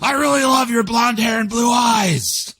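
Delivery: high, slow, shouted mock-German love line; hammed-up German lover bellowing his love. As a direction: yell it like a harsh German serenade. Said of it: "something harsh in German".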